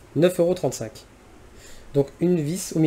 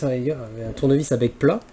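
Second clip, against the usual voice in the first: ordinary talk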